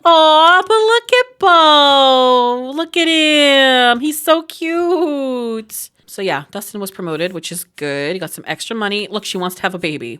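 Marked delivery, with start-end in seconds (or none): 0.0-5.9 gushing over little Bo's cuteness. Real, not acted. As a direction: melt over how cute he is. Cooing over the baby.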